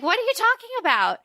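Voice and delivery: high, fast incredulous; mock disbelief. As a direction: squeak it out in disbelief. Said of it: "everyone's like".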